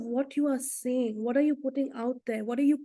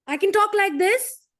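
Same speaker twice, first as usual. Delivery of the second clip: raised, loud and high-pitched; loud forceful tone; 7 semitones higher, 5 dB louder than usual. Shown as a demonstration. Speaker demonstrating tone to the group, loud and high. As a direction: blast it out high and loud to show harsh tone. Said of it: "The tone".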